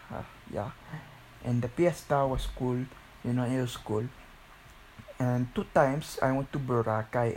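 ordinary delivery

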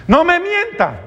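very high-pitched mock scolding; a parent's indignant order, pitch shooting way up; regaño paterno imitado. Papá imitado regaña al hijo. (regaño indignado, muy agudo)

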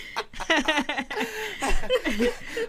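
high burst of laughter; disgusted laughter. Real, not acted. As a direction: cackle at how wild it is. Reacting to ex's comment.

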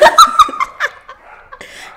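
very high shriek of laughter; squealing laugh. Real, not acted. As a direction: let out a piercing giggle. Friend bursts out laughing.

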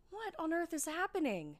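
high, loud panicked cry. Acted. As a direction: cry out in disbelief. Panic at vanished coffee.

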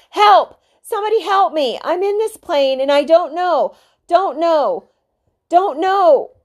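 high, loud desperate screams for help; panicked screaming. A narrator's reading. Boy alone in a plane screams into the radio, panicked screaming. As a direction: scream into the mic like you're falling. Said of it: "he began to scream into the microphone".

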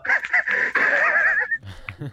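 very high, breathless wheezing laugh; wheezy old man laugh. Shown as a demonstration. Showing the chat his airless old man laugh, high and wheezing. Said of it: "eu dou aquela risada de velho".

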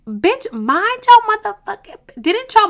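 sudden high indignant shout; indignant yell. Retold or imitated. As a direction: blow up at her, mock furious. Ranting at the nosy officer.

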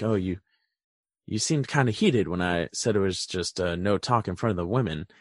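low, soft put-on gruff voice; lightly voiced Crawford. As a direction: drop into a quiet stern doctor. Voicing Crawford retelling show.

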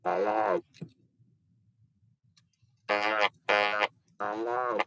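robotic buzzing device speech; electrolarynx voice. Shown as a demonstration. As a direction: speak through an electrolarynx under the chin. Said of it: "I can demonstrate, uh, the electrolarynx".

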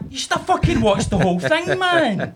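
high, loud mock outburst with a laugh; hammed-up exasperation at dad; mock exasperated rant. Son mocking his dad in a high, loud outraged voice.

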